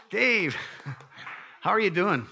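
high, loud delighted call across the room; excited greeting. Real, not acted. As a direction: hail him gladly from the front. Greeting a congregant just out of surgery.

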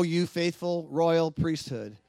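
ordinary talk, nothing marked

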